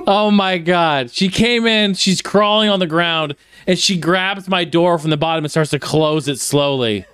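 normal talking voice

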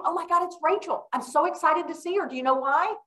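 high, delighted greeting, pitch well up; light up at seeing Rachel; excited. Retold or imitated.

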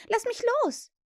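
very high, sharp order; commanding. A narrator's reading. Wendy is pulled to the window, commanding. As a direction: order him off, pitch leaping up. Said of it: "befahl sie ihm".

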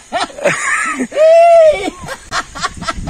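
high, breathless howl of laughter. Real, not acted. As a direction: crack up, shrieking. Burst of laughter at end.

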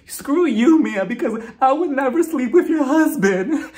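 loud, high hammed-up wail; mock crying and screaming. Host imitates Jacqueline's big scene, loud mock sobbing scream. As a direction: overact a tearful screaming denial. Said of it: "she starts crying and screaming".

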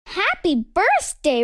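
ordinary delivery, nothing marked

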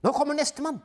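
wife watching the race on TV; eager wife. Acted. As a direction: lean in for the next skier. Wife watching next skier.

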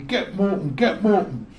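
loud shout down the stairs; urgent, loud calling out. Retold or imitated. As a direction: call it down to the house. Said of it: "you would hear them going downstairs calling".

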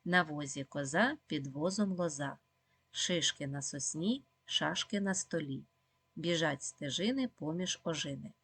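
slow, careful tongue-twister reading; slow, clear recitation for children; slowly. Shown as a demonstration. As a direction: read each word deliberately and slowly. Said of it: "читаємо спочатку повільно".

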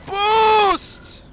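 high, loud yelled command; desperate shout. Real, not acted. To his racing boat, a desperate shout for boost. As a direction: bark the word at the game.

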